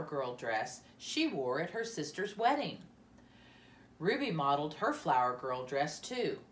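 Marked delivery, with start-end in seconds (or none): none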